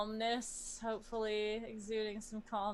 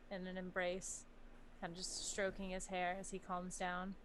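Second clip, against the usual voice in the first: normal talking voice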